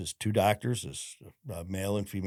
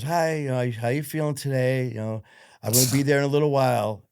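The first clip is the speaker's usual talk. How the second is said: mom's voice; 5 semitones higher, 6 dB louder than usual. Retold or imitated. Mom cheerful on phone.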